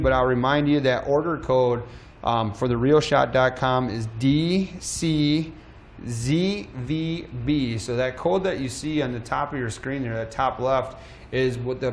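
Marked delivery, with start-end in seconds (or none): 4.2-7.8 slow spelling. Real, not acted. Spelling out an order code.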